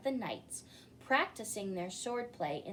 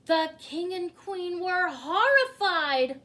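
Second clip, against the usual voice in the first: louder and higher, dramatic alarm; horrified; 6 semitones higher, 10 dB louder than usual. A narrator's reading. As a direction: tell it like shocking news to children. Dramatic horror narration.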